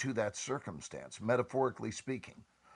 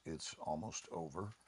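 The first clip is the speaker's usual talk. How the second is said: soft, falling, relieved; soft relieved reading of a familiar phrase; soft sigh of relief; 6 semitones lower, 11 dB quieter than usual. Shown as a demonstration. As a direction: let it settle gently, a sigh of relief. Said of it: "of the pancake to this phrase".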